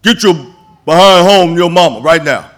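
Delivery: put-on high, loud retelling of a boy being scolded; a boy repeating J D's scolding, loud and high; imitating a boy. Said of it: "the guy said".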